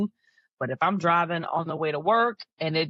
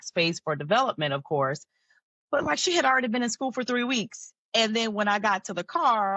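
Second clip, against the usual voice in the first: ordinary talk, nothing marked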